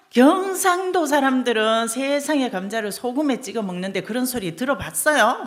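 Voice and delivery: loud and high, not backing down; wife protesting to the judge, loud and indignant. Retold or imitated. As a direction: hit back in disbelief. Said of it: "부인이 지지 않고".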